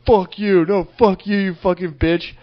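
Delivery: high-pitched, heated retold cursing; mock screaming match. Re-enacting the epic row with the lady, mock angry shouting. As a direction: act out a furious back-and-forth.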